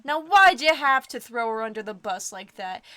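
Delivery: loud mock indignation; 1 mock outrage. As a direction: call out the betrayal loudly.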